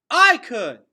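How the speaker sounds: very loud high yell. A narrator's reading. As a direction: yell to silence the crowd. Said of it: "yelled Gregor".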